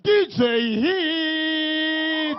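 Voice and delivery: high announcer shout, name stretched long; hype announcer. Real, not acted. Host bringing the DJ on stage, hype announcer. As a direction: whip the crowd into noise.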